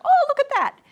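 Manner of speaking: high, loud gasp of surprise; teacher recalling her own delight while preparing; delighted discovery. Retold or imitated. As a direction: burst out as if spotting something wonderful. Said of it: "I was like".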